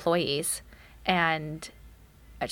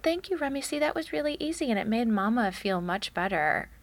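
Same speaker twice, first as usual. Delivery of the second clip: put-on reward the child with a higher, lighter voice; sweet praise to a toddler; 5 semitones higher than usual. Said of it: "and I said".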